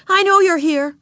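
high, loud, insistent; stern order. A narrator's reading. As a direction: call her bluff with a loud high command. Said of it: "she ordered".

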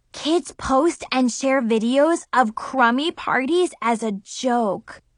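usual speaking voice

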